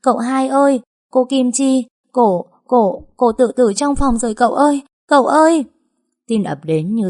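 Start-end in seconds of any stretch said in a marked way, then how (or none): 0.0-5.6 high, loud panicked wail, rising to a scream; a terrified girl yelling through tears for help; frantic sobbing cry that keeps climbing. Acted. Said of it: "Nó vừa khóc vừa gào lên".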